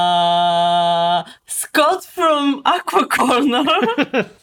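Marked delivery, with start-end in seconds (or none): 1.4-4.3 announcer persona. Acted. Jokey persona introduction.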